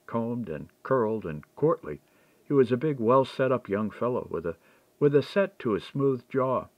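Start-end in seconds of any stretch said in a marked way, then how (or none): none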